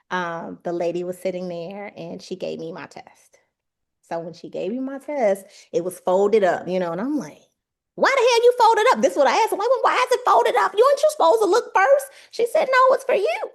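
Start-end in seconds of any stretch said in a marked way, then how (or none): 2.0-3.4 hushed whisper of suspense. Real, not acted. Telling the audience she got her test back, whispered. 7.9-13.5 much higher, louder, rushed; agitated disbelief. Retold or imitated. Asking the test lady why it was folded, high alarmed voice.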